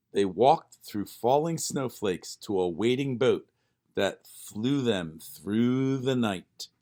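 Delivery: plain reading voice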